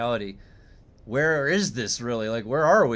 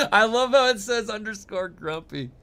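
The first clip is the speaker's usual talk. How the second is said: laughing; 6 semitones higher than usual. Real, not acted. Laughing at chat's nerd remark.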